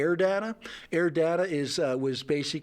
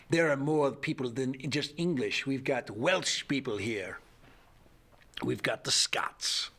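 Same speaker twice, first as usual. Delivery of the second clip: imitating Scotty from Scotland; 2 semitones lower than usual. Quoting Scotty from Scotland in a mild Scottish voice. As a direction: lightly imitate a Scottish friend. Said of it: "He says".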